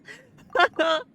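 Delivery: high, loud giggling burst; real burst of laughter. Real, not acted. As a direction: laugh out loud at your own joke. Laughing at own remark.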